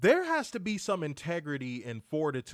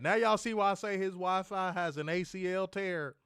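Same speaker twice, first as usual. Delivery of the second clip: normal talking voice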